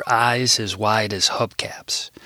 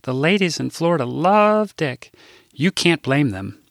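higher, earnest Midwestern lady; innocent Rose; 6 semitones higher than usual. Acted. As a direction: say it with total innocence. Rose innocently says double entendre.

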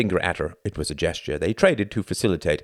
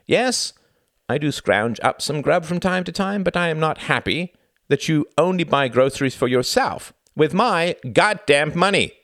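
angry complaint; 11 semitones higher, 4 dB louder than usual. Acted. Reggie arguing.

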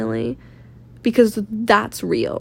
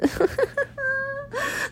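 laughing; 9 dB quieter than usual. Real, not acted. Laughing at her own accent.

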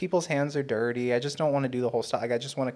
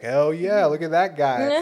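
loud, raised mock whoop of admiration; catcalling girls; 4 semitones higher, 7 dB louder, slower than usual. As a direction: hoot like an imagined admirer. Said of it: "No girls are like".

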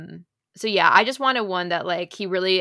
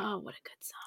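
very soft, whispered swoon; barely voiced fan's sigh; hushed admiration; 15 dB quieter than usual. Real, not acted. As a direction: whisper it in real appreciation. Swooning quietly over a beloved song.

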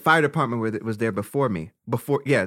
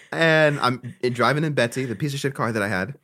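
ordinary delivery

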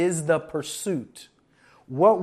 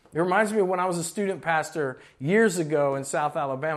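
ordinary talk

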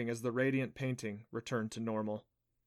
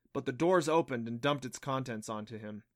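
ordinary delivery

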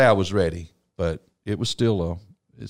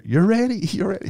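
excited teasing; 12 semitones higher, 3 dB louder than usual. Retold or imitated. Waking wife with excitement.

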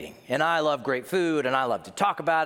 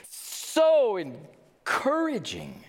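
drawn-out, higher mock delight; sarcastic; 7 semitones higher, much slower than usual. Preacher reacting to grim prophecy, sarcastic. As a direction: stretch it slowly with heavy irony.

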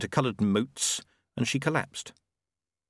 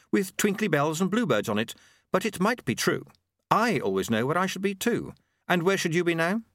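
teasing Susan; 9 semitones higher than usual. A narrator's reading. Voices Susan in dialogue.

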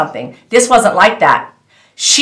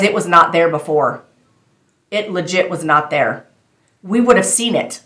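ordinary talk, nothing marked

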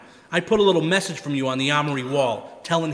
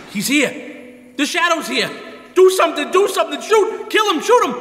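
high, loud frantic pleading; panicked; 11 semitones higher, 6 dB louder than usual. Acted. Gangster begs Spike to shoot the Shadow, panicked shout. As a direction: scream at his partner to shoot, terrified.

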